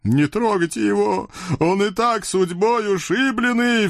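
high cartoon devil voice, protective; chubby devil pleads for friend. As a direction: stand up for his pal. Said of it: "вступился за приятеля толстый чёрт". (толстый чёрт заступается)

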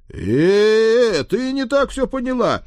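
very high, loud, drawn-out stammer; panicked protest. Acted. Father explains himself to his daughter, panicking. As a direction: blurt the excuse in comic alarm. Father caught in compromising scene.

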